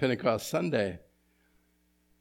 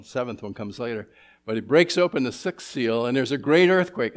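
louder, rising preacher's call over the ruin; preaching at full force; 5 dB louder than usual. Acted. Pastor to the congregation, preaching it loud and urgent. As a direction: preach it big, like the world is shaking. Emphatic passage of the sermon.

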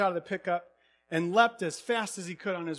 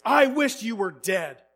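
loud, slow, bitter shout; angry outburst; 6 semitones higher, 12 dB louder, slower than usual. Retold or imitated. Younger son blowing up at his dad on the phone, angry shout. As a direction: hurl it at your father. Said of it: "blew up at his dad".

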